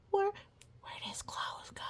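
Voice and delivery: hushed, shocked whisper. Acted. An onlooker whispers about the vanished clothes, shocked. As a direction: whisper it in disbelief.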